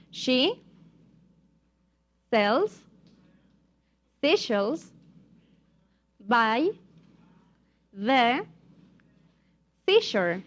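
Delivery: one word at a time, long pauses; word-by-word modelling. Shown as a demonstration. Teacher has students repeat after her, word by word. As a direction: leave room after each word for repetition. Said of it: "You're going to repeat after me".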